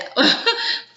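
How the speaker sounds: high breathless giggle; a quick high airy laugh. Retold or imitated. Narrator reacting to the couple leaving, high airy giggle.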